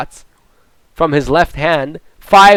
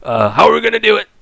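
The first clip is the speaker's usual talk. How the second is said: imitating Donald Trump; 2 semitones lower than usual. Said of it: "Donald Trump was like".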